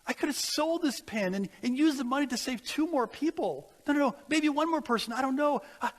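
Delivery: spiral into frantic guilt; desperate regret. Retold or imitated. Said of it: "He says".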